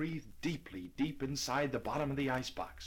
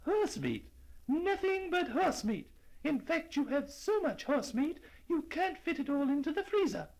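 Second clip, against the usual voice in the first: high, gloating accusation; triumphant old lady. Acted. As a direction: rub it in, triumphant. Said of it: "said the old lady triumphantly".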